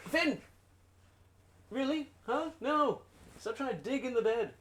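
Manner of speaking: high-pitched, exasperated telling-off of a pet; high pet scolding. Real, not acted.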